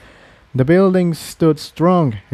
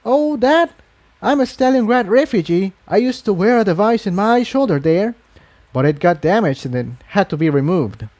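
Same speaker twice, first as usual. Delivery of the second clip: higher, apologetic mare voice; young mare; 8 semitones higher than usual. Acted. Mare apologising to the scarlet unicorn, sheepish. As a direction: own up sheepishly. Incendia explains her scar.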